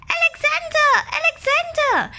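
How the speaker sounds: high, loud frantic calls for a lost duckling; calling out. Acted. Mother duck calling her lost son, high and loud. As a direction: call out high and loud, searching. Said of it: "Alexander's mother quacked and quacked".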